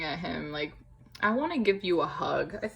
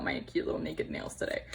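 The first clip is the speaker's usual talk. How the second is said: whisper; 5 dB quieter than usual. Real, not acted. Joking about ruined nails.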